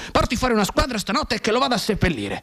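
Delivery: lightly voiced colonel giving orders; commanding colonel. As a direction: bark it like a colonel.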